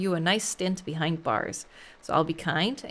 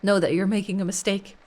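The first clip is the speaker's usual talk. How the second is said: normal talking voice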